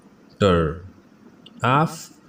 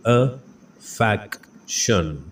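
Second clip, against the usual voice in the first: slow syllable by syllable; much slower than usual. Shown as a demonstration. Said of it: "pronunciation drill".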